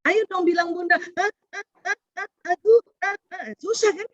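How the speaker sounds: mother coaxing. Retold or imitated. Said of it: "Kan kata ibunya ya".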